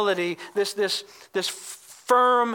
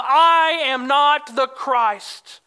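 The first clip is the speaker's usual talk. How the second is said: "I am not the Christ" loud, slow and higher, long stressed "I"; emphatic denial; 4 semitones higher, 5 dB louder, much slower than usual. Retold or imitated. John answers their question, a forceful emphatic denial. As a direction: hit "I" and "not" hard, drawn out. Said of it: "they're emphatic".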